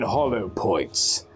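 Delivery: ordinary delivery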